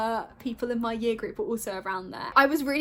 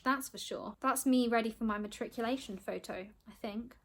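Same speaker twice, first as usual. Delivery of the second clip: ordinary talk, nothing marked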